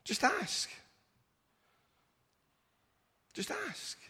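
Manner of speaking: high, gentle coaxing voice, fading away; God inviting. Retold or imitated. God urging his children to simply ask, high and fading. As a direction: coax softly, almost pleading. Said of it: "He says".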